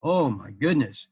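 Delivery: ordinary delivery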